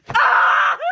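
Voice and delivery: sudden high, loud scream. Real, not acted. Reader overcome by a romantic line, screaming. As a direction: burst out in a loud high shriek.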